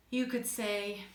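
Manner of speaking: ordinary talk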